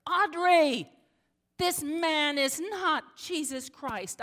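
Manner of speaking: loud, high furious yell; hollering. Retold or imitated. Pastor loses her cool and hollers at Audrey, angry yell. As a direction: yell the truth at her, slow and hard. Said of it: "I yelled at her, I hollered at her".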